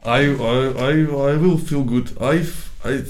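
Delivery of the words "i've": normal talking voice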